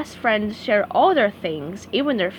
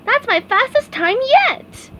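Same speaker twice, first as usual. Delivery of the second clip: SpongeBob voice; 13 semitones higher, 5 dB louder than usual. A narrator's reading. SpongeBob bragging to Patrick.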